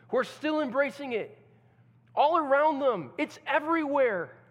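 loud, high preaching; fiery preaching. Acted. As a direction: pour it on, voice climbing. Preaching builds again.

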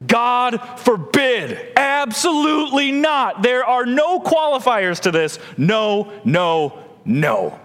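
slow, high God forbid then falling nos; forceful denial. Retold or imitated. Pastor voicing the translators, forceful absolute no. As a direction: declare it absolutely, no room. Acting the strongest possible no.

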